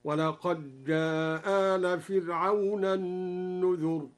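"ولقد جاء آل" with dal merged into jim, one hamza dropped, short vowel hold; slow recitation, waqaj-jaa-aal, shorter stretch on "جاء"; merged dal, short madd. Shown as a demonstration. Teacher showing al-Duri's reading, "ولقد جاء" merged, one hamza, short madd. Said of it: "وقرأ بإسقاط إحدى الهمزتين على القصر والمد".

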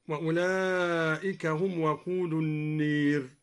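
slow recitation, النير said "an-neer" for an-naar; measured chant with a fronted vowel in النير; imala. Shown as a demonstration. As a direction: tilt the long a of النير toward ee. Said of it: "وكذا أمال".

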